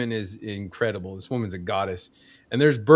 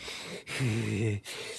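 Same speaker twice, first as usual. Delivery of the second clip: slow, soft wheezing breaths; imitated heavy breathing; 4 semitones lower, 10 dB quieter than usual. Fat sweaty guy sitting next to him in theater, heavy breathing. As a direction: breathe heavily like a winded man. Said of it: "he's just breathing heavily".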